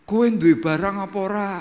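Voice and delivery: high, urgent acted question; mimicked friend, pitched high; imitating demanding friend. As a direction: pester him for the goods, voice up. Acts friend demanding drugs.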